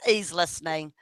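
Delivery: ordinary talk, nothing marked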